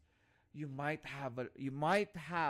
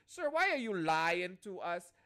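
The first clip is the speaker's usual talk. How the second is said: protesting student; 3 semitones higher, 6 dB louder than usual. Retold or imitated. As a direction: put on a student's accented, accusing complaint. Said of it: "say it in the same accent".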